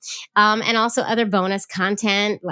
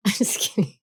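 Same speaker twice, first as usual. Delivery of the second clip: laughing; 2 semitones higher than usual. Real, not acted. Laughs at own plea to listeners.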